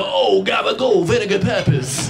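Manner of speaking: high, slow, hammed-up Italian-American accent; mock Italian. Comic mocking his Italian in-laws, hammed-up Italian-American accent. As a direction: ham up the gabagool Italian.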